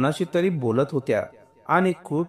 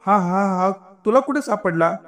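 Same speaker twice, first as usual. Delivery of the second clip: high, stammering astonishment; joyful disbelief; 6 semitones higher than usual. A narrator's reading. Woman seeing her lost necklace, astonished and overjoyed. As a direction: stumble on the first word, pitch lifted in amazement. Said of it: "डोळ्यात अविश्वास आणि त्यानंतर तीव्र आनंदाच्या".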